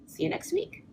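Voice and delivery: breathy whispered goodbye; whispering. Real, not acted. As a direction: whisper it softly. Whispered sign-off.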